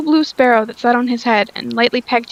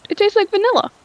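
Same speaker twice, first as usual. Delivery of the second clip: much higher, surprised exclamation; 10 semitones higher than usual. Acted. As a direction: marvel at the taste, pitched way up. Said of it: "I say in surprise".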